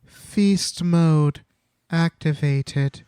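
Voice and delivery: deliberate, drawn-out title reveal; mock announcement. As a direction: announce it word by word with pauses. Answers with a mock chapter title.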